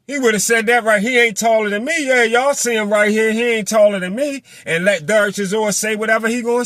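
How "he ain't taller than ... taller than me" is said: loud, high mock challenge for everyone to hear. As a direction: announce it boldly at full volume. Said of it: "said that shit out loud".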